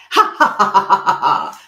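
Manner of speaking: high, loud ha-ha laugh; staged laugh. Shown as a demonstration. Demonstrating laughter for the audience, a loud ha-ha laugh. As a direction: burst out laughing on cue.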